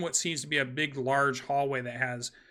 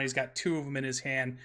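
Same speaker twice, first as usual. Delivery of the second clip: usual speaking voice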